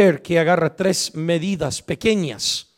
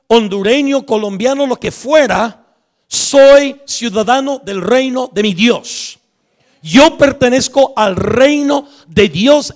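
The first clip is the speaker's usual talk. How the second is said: forceful preacher's proclamation; emphatic preaching; 3 semitones higher, 4 dB louder than usual. Acted. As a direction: proclaim it to the congregation, stretching key words. Preaches a declaration of allegiance.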